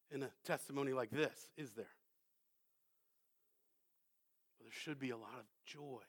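low, very soft aside to the congregation; hushed preaching. Real, not acted. Preacher asking the congregation about their testimony, hushed. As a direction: drop down to a quiet, searching murmur.

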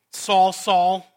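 stern rebuking call of the Lord; rebuke. Acted. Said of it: "it was a mark of rebuke".

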